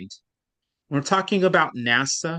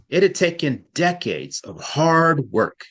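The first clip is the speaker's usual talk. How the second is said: "decades" and "hard work" hit with deliberate weight; stress on decades. Shown as a demonstration. Teacher models for practice reading, stress on "decades". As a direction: read it measured, punching "decades" and "hard work". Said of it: "practice reading with me".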